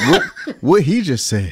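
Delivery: laughing. Real, not acted. Guest dodges following the DJ's hype, laughing.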